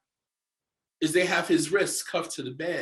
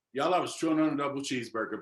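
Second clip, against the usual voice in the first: normal talking voice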